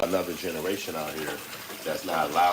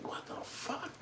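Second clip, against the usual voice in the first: hushed, high, stunned disbelief; acted shock; 13 dB quieter than usual. City person shocked by the country, hushed mock shock. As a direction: gasp it softly, high-pitched. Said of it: "that shit is a shock".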